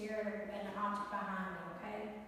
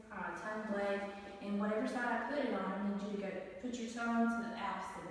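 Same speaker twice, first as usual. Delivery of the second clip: normal talking voice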